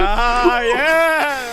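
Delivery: high, loud hammed-up "yes!"; mock wrestler voice. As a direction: shout it like a wrestling gimmick.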